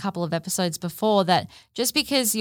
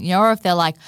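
ordinary delivery

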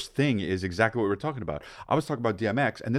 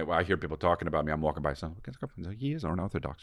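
soft gossiping murmur, lower; hushed; 4 semitones lower, 9 dB quieter than usual. Retold or imitated. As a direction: hush like overheard gossip. Retells people gossiping about him.

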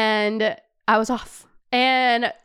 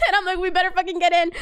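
high, laughing warning; laughing nervous hope; 8 semitones higher, faster than usual. Real, not acted. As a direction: giggle through it.

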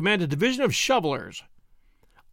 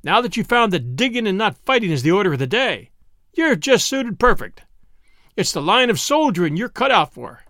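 a hint of a resentful taunt, louder; lightly voiced bitter cook; 6 semitones higher, 5 dB louder than usual. A narrator's reading. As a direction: jeer at Zeb's digging. Zeke taunts Zeb.

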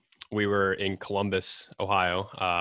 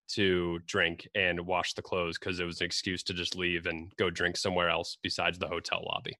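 ordinary talk

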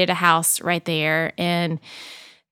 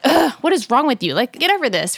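mock exasperated snap at the kids, pitched higher; a higher, hammed-up ugh to grumpy girls; exasperated mom; 6 semitones higher, 3 dB louder than usual. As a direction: play the fed-up mom, half-joking. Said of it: "I'm like".

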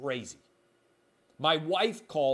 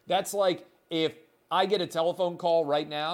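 normal talking voice

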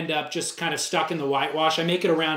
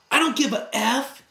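higher imitated snap of a shout; mock shout; 6 semitones higher than usual. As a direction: throw back a retold shout. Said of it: "and just shouts".

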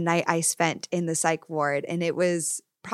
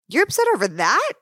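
mock scornful disbelief; 8 semitones higher, 5 dB louder than usual. Gaslighter mocking partner's feelings.

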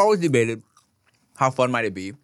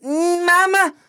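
high babbling baby imitation; slower than usual. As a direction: coo it like a baby finding the word. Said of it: "she went".

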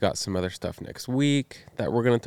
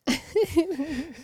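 burst of laughter; 3 dB quieter than usual. Real, not acted. Laughing at her own bad news.

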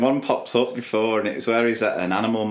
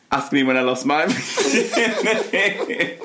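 slow, higher, laughing through it; laughing imitation; 7 semitones higher, slower than usual. Retelling the religious man, laughing slowly. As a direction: giggle out the line. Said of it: "And then he's like".

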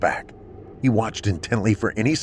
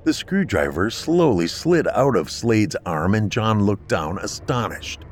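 plain reading voice

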